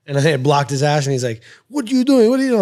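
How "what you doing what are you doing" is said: high-pitched mock demand; indignant rival. Fighter retelling a rival's challenge in the bathroom line, high and indignant. Acting out rival during retold argument.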